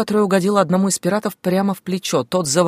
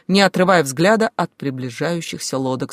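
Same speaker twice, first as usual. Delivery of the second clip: ordinary delivery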